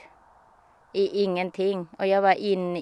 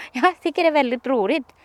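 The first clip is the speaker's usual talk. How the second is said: laughing; 5 semitones higher, 4 dB louder, faster than usual. Real, not acted. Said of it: "Skrattar jag".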